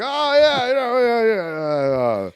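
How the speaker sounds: high, forced yes-yes; fake enthusiasm. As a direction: play along too eagerly. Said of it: "We're like".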